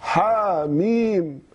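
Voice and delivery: حميم recited slowly with open a, ha mim; Hafs reading. Shown as a demonstration. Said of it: "الذي أنت تعرفه لحفص".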